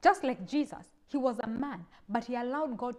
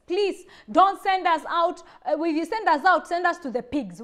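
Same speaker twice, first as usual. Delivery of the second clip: pleading, louder and higher, slowed on the first plea; begging demons; 4 semitones higher, 8 dB louder than usual. Acted. Begging demons, higher and louder. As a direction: demons pleading not to be cast out.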